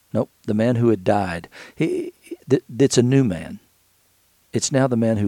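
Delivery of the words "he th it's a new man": ordinary talk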